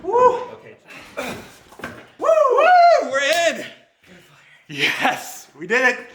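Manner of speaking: high, loud triumphant whoops; excited cheering. Real, not acted. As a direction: celebrate the win with the crew. Lamp finally moved inside.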